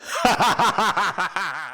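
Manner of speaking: a high, breathy madman's laugh; gleeful mad laugh. Acted. Killer laughing at how he hid the body, gleeful and mad. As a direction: chuckle at your own cunning, unhinged. Murderer gloats over his cleverness.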